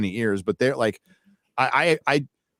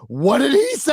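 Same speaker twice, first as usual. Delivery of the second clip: mock baffled; 11 semitones higher than usual. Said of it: "I'm like".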